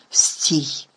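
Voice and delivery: urgent whispered stop; stopping a friend so they aren't heard, whispered. A narrator's reading. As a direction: hush her in a sharp whisper. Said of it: "прошепотів він Герміоні".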